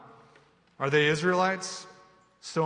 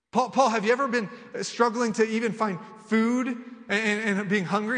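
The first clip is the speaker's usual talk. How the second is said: raised, louder incredulous voice challenging Paul; a doubter pressing Paul, incredulous and raised; doubting listener; 8 semitones higher, 7 dB louder than usual. Retold or imitated. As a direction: push back on Paul in disbelief. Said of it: "You say".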